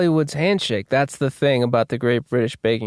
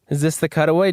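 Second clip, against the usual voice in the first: ordinary talk, nothing marked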